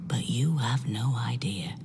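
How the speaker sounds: whispered, slow ominous warning. Acted. Detective warning the recruit, slow whisper. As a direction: breathe it out slowly as a warning.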